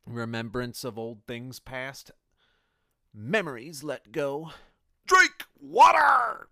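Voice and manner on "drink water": screaming high, slow and loud; drill sergeant scream. Retold or imitated. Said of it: "the drill sergeants used to scream that in that funny cadence".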